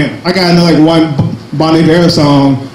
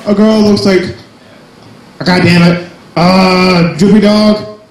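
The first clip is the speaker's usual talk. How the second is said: ordinary delivery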